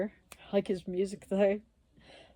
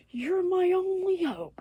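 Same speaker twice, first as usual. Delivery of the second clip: much higher, slow, whiny lovesick voice; mock desperate plea; 12 semitones higher, 4 dB louder, slower than usual. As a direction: ham up a pathetic begging guy. Lovesick guy begging, retold.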